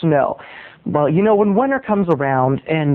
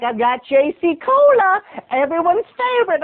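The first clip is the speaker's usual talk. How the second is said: Irish neighbor; 9 semitones higher than usual. Acted. Irish neighbor offers boy cola, Irish coaxing. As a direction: tempt him with an Irish lilt. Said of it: "That's her Irish".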